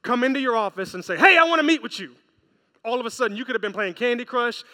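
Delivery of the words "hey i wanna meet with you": loud, raised-pitch boss summons; acted attention-grabbing boss; boss calling out. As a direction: call out abruptly like a boss at the office door. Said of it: "let your boss".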